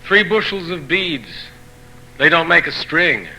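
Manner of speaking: measured proverb recital, each phrase starting high and falling at the end; poetry reading. Acted. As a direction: read each saying in separate phrases, pausing between them. Poet reads translated proverbs aloud.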